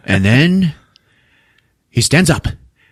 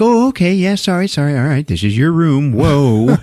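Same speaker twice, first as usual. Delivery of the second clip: mock apology; 6 semitones higher than usual. Partygoers caught in her bedroom, mock apologetic. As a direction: back off with exaggerated, high-pitched sorries.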